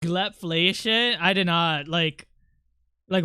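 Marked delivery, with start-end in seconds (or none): none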